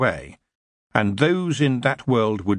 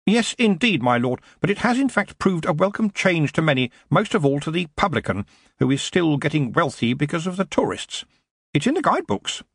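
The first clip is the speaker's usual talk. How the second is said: higher-pitched, eager aide; secretary's voice, raised in pitch; deferential secretary; 7 semitones higher than usual. Acted. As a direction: politely put the case to a superior.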